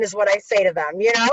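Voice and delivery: ordinary talk, nothing marked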